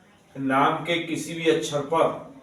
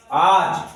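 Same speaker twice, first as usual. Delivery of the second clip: आज with the आ punched louder; stress on आ; 5 dB louder than usual. Shown as a demonstration. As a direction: hit the आ of आज hard. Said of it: "यहाँ आप पर जोर आ रहा है". (आज में आ पर ज़ोर)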